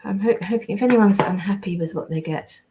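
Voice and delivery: ordinary delivery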